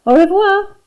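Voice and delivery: high, loud, sung-out farewell with AU REVOIR; calling after. A narrator's reading. Teacher bids Dennis goodbye as he leaves, calling out. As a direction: call a bright French goodbye after someone. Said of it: "she called after him".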